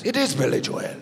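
imitated Irish comic; Irish accent. Irish comic backs up the sound guy, Irish accent. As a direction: insist in a put-on Irish accent. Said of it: "the Irish comic goes".

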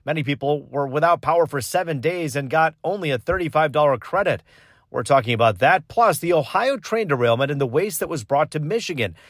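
loud, upbeat broadcast opener; punchy host welcoming the audience; show host intro. Acted.

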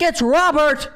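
loud, high, indignant correction; mock outraged announcement at a restaurant. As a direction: stand up and insist on your real name. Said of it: "I was like".

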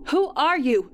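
frightened high yell into the dark house. A narrator's reading. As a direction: call out scared and angry. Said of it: "I yelled into the house".

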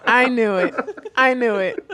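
loud laughing "I knew it"; laughing triumph. Real, not acted. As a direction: crow it out, laughing. Excited realization, laughing.

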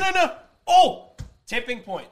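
sudden excited recall; high, loud eureka. Real, not acted. Suddenly remembers.

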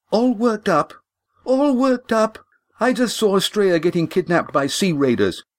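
high, incredulous outrage; indignant echo. Acted. Lindy echoing Walt's words, high and indignant. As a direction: throw his words back at him. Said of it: "You're all worked up".

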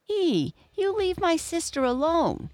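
high, bold cry of a little hippo standing up for his sister; brave cry. Acted. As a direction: call out bravely, pitch way up. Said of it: "cried Chunky bravely".

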